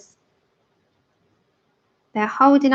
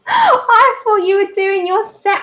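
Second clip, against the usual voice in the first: laughing; 8 semitones higher, 3 dB louder than usual. Real, not acted. Laughing at her own mistake.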